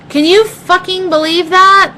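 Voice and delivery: can you believe this, incensed; outraged disbelief. Real, not acted. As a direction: let the outrage burst out to the viewers. Outraged at dad forgetting.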